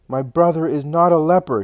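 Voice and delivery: high, loud outburst of denial; convulsive shout. A narrator's reading. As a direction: shout it out in sudden distress. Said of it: "shouts Martha convulsively".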